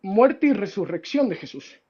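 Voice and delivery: ordinary talk, nothing marked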